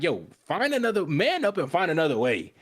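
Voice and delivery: high, exasperated mock telling-off; retold scolding. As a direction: imitate her urging him to toughen up. Acted scolding of panicking boy.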